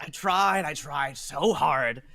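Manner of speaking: exasperated captain rant; grumpy captain. Acted. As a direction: vent like a fed-up ferryman.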